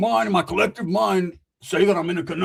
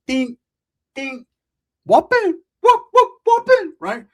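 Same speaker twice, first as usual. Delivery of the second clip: very high, loud whooping; excited fight noises; 13 semitones higher, 8 dB louder, slower than usual. Acted. Narrating the beatdown with shrill whoops. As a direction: whoop like a brawl.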